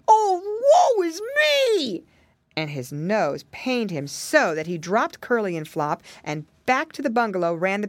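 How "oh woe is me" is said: high, drawn-out wail; crying out in pain. A narrator's reading. Bear with a hurt nose, wailing. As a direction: wail it loudly, stretching it out slowly. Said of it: "he cried".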